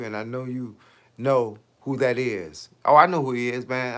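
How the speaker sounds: ordinary talk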